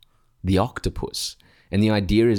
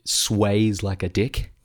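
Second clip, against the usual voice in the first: ordinary delivery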